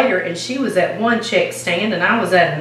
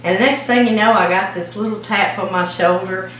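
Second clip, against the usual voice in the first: ordinary talk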